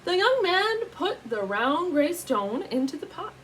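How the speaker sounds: ordinary delivery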